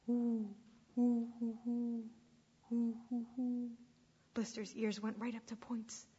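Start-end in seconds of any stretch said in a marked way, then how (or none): 0.1-3.7 imitated hoot owl; round, repeated owl hoots. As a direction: hoot like an owl in short bursts. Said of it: "it was a hoot owl".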